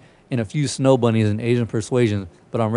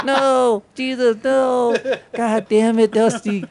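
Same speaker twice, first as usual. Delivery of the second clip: much higher, shrill-free mock panic of the woman filming; panicked cat owner; 10 semitones higher, much slower than usual. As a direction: imitate the lady recording her cat, high and desperate. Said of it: "the lady recording was like".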